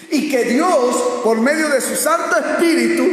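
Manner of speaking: high, emphatic pulpit cry; forceful preaching. Real, not acted. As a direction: proclaim it to the congregation.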